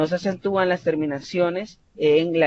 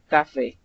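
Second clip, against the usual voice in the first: "café" stressed on FÉ; ca-FÉ, end-stressed; stress on last syllable. Shown as a demonstration. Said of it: "mayor fuerza de voz al final".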